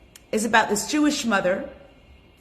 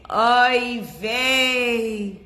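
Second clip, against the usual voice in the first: lament; 3 semitones higher, 4 dB louder than usual. Acted. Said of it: "Second mother goes".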